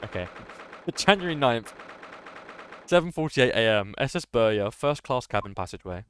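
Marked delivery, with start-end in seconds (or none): 0.9-5.0 louder announcement. Shown as a demonstration. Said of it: "My dad's birthday. Okay".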